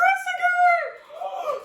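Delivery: sharp high gasp of amazement. Real, not acted. Sharp gasp on seeing symbol.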